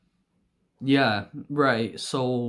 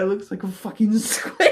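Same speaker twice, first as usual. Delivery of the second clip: high voice giving way to laughing; breaking into laughter; 7 semitones higher, 3 dB louder than usual. Real, not acted. Son quoting dad on the bike, real laughter taking over. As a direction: squeak the quote out before cracking up.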